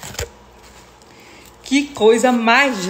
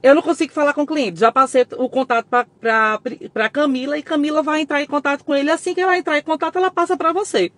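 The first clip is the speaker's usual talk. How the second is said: fast, higher retelling of the friend's excuse; hurried friend; 7 semitones higher than usual. Retold or imitated. Retelling Priscila's hurried excuse on the phone, fast and higher. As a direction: rattle it off like a friend covering a story. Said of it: "E Priscila dizendo assim".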